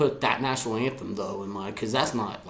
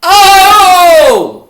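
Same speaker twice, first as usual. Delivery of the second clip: long, loud, high crowd roar; imitated fan chant, held long; imitating crazed fans; 14 semitones higher, 12 dB louder than usual. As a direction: holler it like a whole stadium answering. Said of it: "fans go crazy and say".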